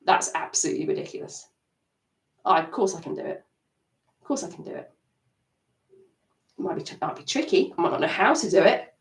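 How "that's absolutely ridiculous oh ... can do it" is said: dismissive. Shown as a demonstration. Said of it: "it's gonna move to".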